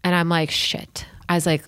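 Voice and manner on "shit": breathless whispered curse. Retold or imitated. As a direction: whisper it so the teacher won't hear. Whispered panic during silent exercise.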